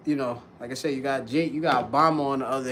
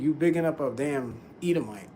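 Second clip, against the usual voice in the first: normal talking voice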